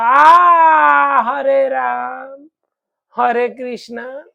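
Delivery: high wail dropping into slow, drawn-out chant; acted agonised howl, stretched long; crying out in pain. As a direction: wail the holy names under duress. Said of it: "चिल्ला रहा था दर्द से".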